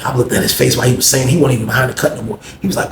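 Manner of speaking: normal talking voice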